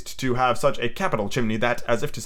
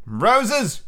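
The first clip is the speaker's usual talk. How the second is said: Peter's sharp, stern exclamation, higher; stern cry; 8 semitones higher than usual. A narrator's reading. Peter correcting the boys, stern cry. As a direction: snap it like a boy giving orders. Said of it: "cried Peter sternly".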